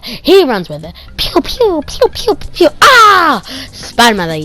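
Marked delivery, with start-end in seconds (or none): 1.2-2.7 high pew-pew zaps; high laser sound effects; blaster noises. Retold or imitated. Kid imitating blaster fire. 2.8-3.4 high loud yell; mock charging hero yell; battle cry.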